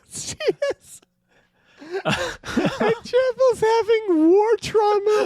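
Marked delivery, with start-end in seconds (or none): 2.1-5.3 high, drawn-out shout through laughter; losing it, high and slow; helpless hilarity. Real, not acted. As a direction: crack up while crying it out. Cracking up at absurd scene.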